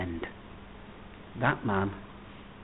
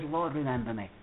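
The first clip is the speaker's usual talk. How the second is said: low, soft, simple plea; soft humble plea; 3 semitones lower, 8 dB quieter than usual. Retold or imitated. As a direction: drop to a hushed prayer. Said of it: "He just says".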